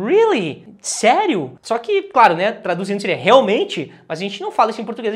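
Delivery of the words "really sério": surprised reply. Shown as a demonstration. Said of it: "E você responde".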